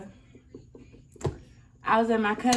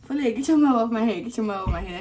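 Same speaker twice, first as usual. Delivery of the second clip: urgent plea; 2 semitones higher, 7 dB louder, much faster than usual. Retold or imitated. Hair being pulled.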